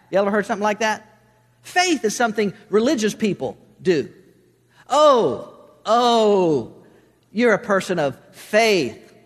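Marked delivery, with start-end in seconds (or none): 4.9-9.1 drawn-out, loud mocking "oh"s, then slow; mock disdain. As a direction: say it as if faith were a dirty word. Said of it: "As if that's a four-letter word".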